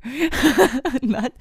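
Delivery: laughing through the words. Real, not acted. Host laughing at her own insecurity. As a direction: laugh as you say it. Laughing while self-criticizing.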